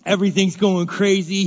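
ordinary talk, nothing marked

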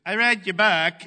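high, loud mocking mother voice; mom's voice. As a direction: put on mom's accusing tone. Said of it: "She goes".

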